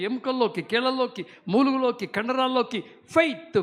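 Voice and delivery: loud, rising chant of a revival preacher; full-force preaching. Acted. Pastor to congregation, driving faith into their bones, loud and fervent.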